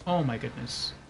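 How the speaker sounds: ordinary delivery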